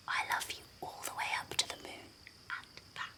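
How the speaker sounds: very soft, tender bedtime whisper; smiling whisper. A narrator's reading. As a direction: whisper it with a smile. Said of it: "whispered with a smile".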